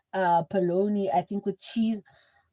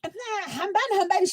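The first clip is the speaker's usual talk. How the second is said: much higher mock shriek chasing them out; mock scream; 10 semitones higher than usual. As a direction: shriek "get out" like the aunt. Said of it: "she would scream on top of her voice".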